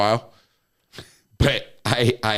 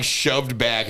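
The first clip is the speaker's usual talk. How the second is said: normal talking voice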